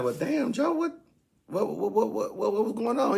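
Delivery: mock alarmed squeak. Narrator to Joe, a high alarmed stammer. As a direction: squeak out the repeated whats in alarm.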